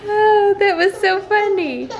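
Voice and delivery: high, breathless laughter with an ouch; giggling helplessly while it aches; laughing through pain. Real, not acted. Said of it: "it hurts".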